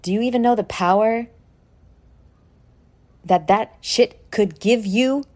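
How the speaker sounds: normal talking voice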